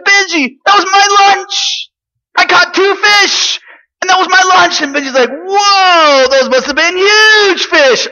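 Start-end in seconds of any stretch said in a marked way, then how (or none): 0.0-4.8 Sammy telling Benji the food was his lunch; insistent boy. Acted. 5.3-8.1 high, loud, slow amazement; amazed friend. Acted. Benji amazed.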